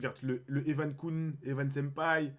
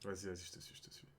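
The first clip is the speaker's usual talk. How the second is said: soft unvoiced whisper; 9 semitones lower, 20 dB quieter, faster than usual. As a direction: whisper along playfully. Playing along whispering.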